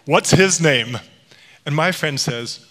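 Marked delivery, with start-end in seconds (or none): 0.0-1.0 put-on loud, jovial cheer for a baby boy; boisterous acted admiration; hearty acquaintance. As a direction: whoop it, imitating a man tossing a baby. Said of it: "throwing the baby up in the air and goes".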